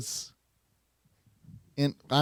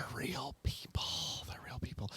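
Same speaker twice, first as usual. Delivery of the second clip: whisper; 2 semitones lower, 17 dB quieter than usual. Pretend. Joking whisper.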